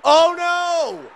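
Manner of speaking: sudden high yelp of dismay; dismayed shout. Real, not acted. Gamer yelling at the conceded goal, a high dismayed shout. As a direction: cry out as the goal goes in.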